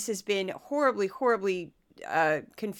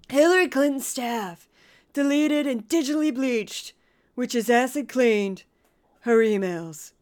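rough attempt at a stump-speech politician, louder; Donald Trump; 2 semitones higher, 5 dB louder than usual. Podcaster quoting a campaign speech, loud Trump impression. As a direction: punch out short phrases like a rally speaker, pausing between. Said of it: "I don't do a great Trump".

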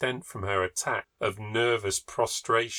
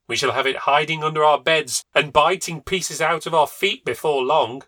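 higher, louder complaint; aggrieved husband; 6 semitones higher, 7 dB louder than usual. A narrator's reading. Husband grumbling about the otter, loud aggrieved tone. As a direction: grumble in alarm.

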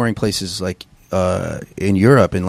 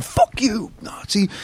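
high mock yell of a drunk kid; mock taunt; 11 semitones higher, 3 dB quieter, slower than usual. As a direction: taunt them from the van door, pitched up. Friends taunting neo-Nazis from van.

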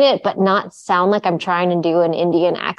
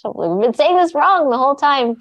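high, shocked exclaiming; mock dismay at her own mistake; retold shock; 9 semitones higher than usual. Realizing she said it wrong, shocked and high. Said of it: "I was shocked".

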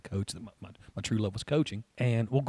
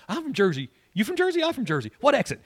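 higher, louder mock Jersey-boy back-and-forth; Jersey guys bantering; 11 semitones higher, 8 dB louder than usual. Coach mimics Jersey boys swapping exits, higher and louder banter. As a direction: ham up two Jersey guys sizing each other up. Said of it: "the conversation the Jersey boys say".

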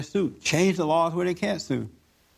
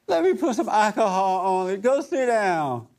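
higher, slow, coddling mother voice with a laugh; a hammed-up fussing mama, higher and drawn out; doting mama; 6 semitones higher, slower than usual. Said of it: "Mama would have said".

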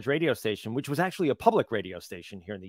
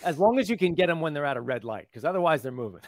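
normal talking voice

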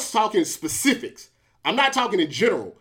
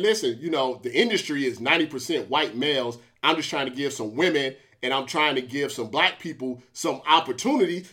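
softer, calm, patient explaining; a quiet, lower imitation of her calm voice; gently laying it out; 8 semitones lower, 4 dB quieter than usual. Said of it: "tried to explain it very calmly".

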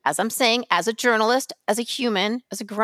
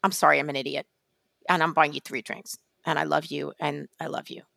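softer, lower mock-contrite apology; sheepish apology; 8 semitones lower, 6 dB quieter than usual. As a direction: play the friend owning up, hushed and meek. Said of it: "he would say".

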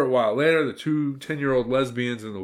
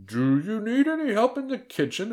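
very high, dainty falsetto offer; put-on feminine voice; 12 semitones higher, 3 dB louder than usual. A narrator's reading. Disguised wolf offers the aunt help, falsely feminine. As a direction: ask in a false ladylike pitch.

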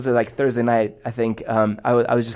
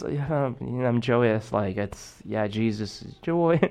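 mumbled, much softer, lower dutiful reply; a churchgoer answering on autopilot; rote flat answer; 4 semitones lower, 7 dB quieter than usual. Retold or imitated. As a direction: lifeless mock yes, Jesus is joy.

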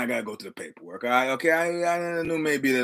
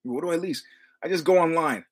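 ordinary delivery

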